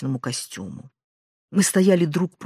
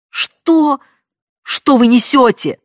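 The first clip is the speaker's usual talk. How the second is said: breathless shout; 9 semitones higher, 10 dB louder, slower than usual. A narrator's reading. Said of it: "задыхаясь, выкрикнула я".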